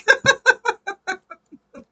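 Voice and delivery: high, loud cackling laugh; burst of laughter. Real, not acted. Reader cracks up after big girl joke, burst of laughter. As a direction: crack up at your own joke.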